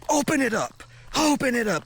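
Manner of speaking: high-pitched forceful order; really aggressive. Retold or imitated. Guard orders traveler to open suitcase, really aggressive. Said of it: "like, really aggressively".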